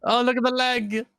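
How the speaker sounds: high, thrilled squeal; delighted coo. Real, not acted. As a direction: squeal at the puppy's leg. Cooing over dog.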